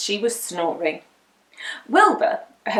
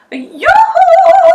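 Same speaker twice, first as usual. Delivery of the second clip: very loud, high yoo-hoo shout; shouting to the mother dragon; calling out loud; 13 dB louder than usual. A narrator's reading. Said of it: "called Winnie".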